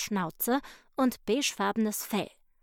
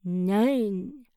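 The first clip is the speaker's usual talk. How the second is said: mürrisch grollendes Nein; gegrollt; 5 dB louder than usual. A narrator's reading. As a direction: groll es knapp heraus. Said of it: "grollte der Mops". (der Mops grollt Lotte ein Nein entgegen)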